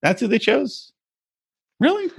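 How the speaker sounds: high disbelieving really; reacting to the sushi model, mock disbelief; incredulous. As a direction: scoff in disbelief, pitch up. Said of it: "I was like".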